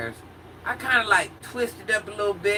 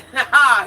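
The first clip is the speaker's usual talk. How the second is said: laughing cry; 7 semitones higher, 11 dB louder than usual. Real, not acted. Laughing cry after quote.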